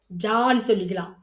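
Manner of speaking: ordinary delivery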